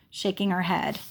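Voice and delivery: plain reading voice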